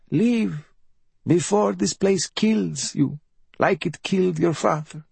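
high, self-pitying bedridden mother; high-pitched raspy invalid voice; rasping mother. A narrator's reading. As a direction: rasp it high, a mother playing at dying. Mother urging her son to leave.

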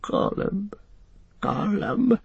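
high, thin throat whimper. A narrator's reading. Gollum face to the ground, whimpering. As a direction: whimper in small broken bursts. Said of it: "he whimpered".

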